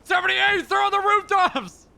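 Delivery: alarmed shout. Retold or imitated. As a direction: shout it like a frantic warning. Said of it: "the second he smells the ice cream".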